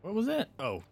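normal talking voice

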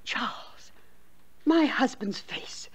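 a scared, breathless whisper of the name; hushed, afraid whisper; frightened whisper. Acted. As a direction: whisper it in fear. Frightened aunt confides ghost.

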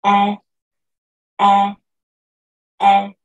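E held loud with lips half open; open é vowel. Shown as a demonstration. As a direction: drill the vowel E. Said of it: "Miren cómo yo pongo la posición de mis labios".

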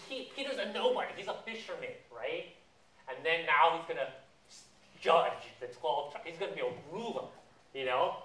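usual speaking voice

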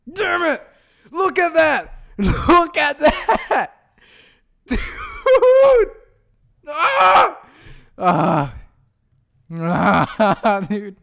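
high, loud laugh-shouting; despairing laughter. Real, not acted. Gamer laughing at his own loss, high and loud. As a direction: laugh and yell in disbelief.

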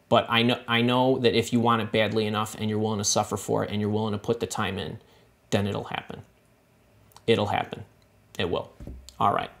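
fighting not to cry; holding back tears. Real, not acted. Vlogger promising a viewer success, softly near tears. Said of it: "I ain't crying this time".

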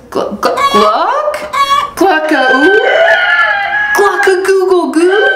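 voice cracking crow. Acted. Rudy's voice changing again, crow stuttering and cracking. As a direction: stumble into the crow, then let it crack. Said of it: "Now he says".

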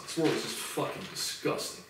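whispered in bitter disgust; hushed, unvoiced disgust; real disgusted whisper. Real, not acted. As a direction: breathe it out, spent and sickened. Bitter end of angry rant.

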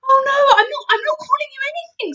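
high, loud, hurried protest; pleading cry. A narrator's reading. Girl placates the offended giant, high urgent cry. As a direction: rush out an anxious denial. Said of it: "Sophie cried".

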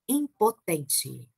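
slow, spiteful "Impotente"; slow cruel emphasis. Acted. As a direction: drag "Impotente" out very slowly. Final cruel revelation, very slow.

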